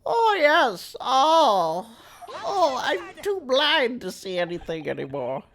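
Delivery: put-on high, loud creaky old man. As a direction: play the doddering old witness. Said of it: "trying to do this old man voice".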